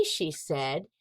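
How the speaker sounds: ordinary delivery, nothing marked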